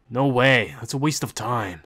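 ordinary delivery

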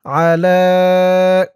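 আলা with the second a held very long; slow chanted আলা, vowel drawn out; long stretched vowel. Shown as a demonstration. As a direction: pull out the lengthened a in আলা. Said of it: "টান দিয়ে পড়তে হবে".

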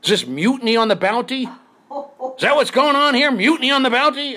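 high, loud hammed-up indignation; mock outrage. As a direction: rant about mutiny like a wronged captain. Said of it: "and I said".

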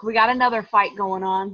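ordinary talk

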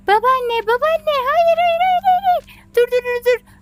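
high, loud frantic hayır and dur; high loud panicked yelling; panicked shouting. Real, not acted. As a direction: shout in panic to stop her. Grandma swallowed the teeth.

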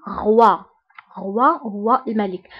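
غوا with wa sound; French roi. Shown as a demonstration. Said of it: "كنطقوها بوا".

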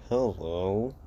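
a strained greeting forced through clenched teeth; through gritted teeth. Acted. Deku greets his father through gritted teeth. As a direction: spit out hello with barely held anger. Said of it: "gritting his teeth".

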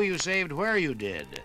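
usual speaking voice

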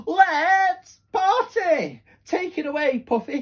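high, loud, drawn-out party call; joyful cry. Acted. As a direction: shout it with pure glee. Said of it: "he cried".

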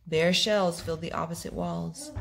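plain reading voice

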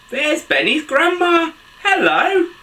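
put-on high, eager children's-story hello; excited greeting. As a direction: greet her with pitch lifted way up. Spots and greets grandma in story.

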